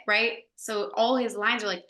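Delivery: ordinary delivery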